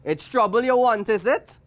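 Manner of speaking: disapproving shopkeeper voice. A narrator's reading. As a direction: ask it loud and high, sizing up the customer. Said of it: "asked the shopkeeper".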